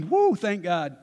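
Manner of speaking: Abraham's grown-up sigh of relief; relieved father. Retold or imitated. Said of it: "And Abraham's thinking".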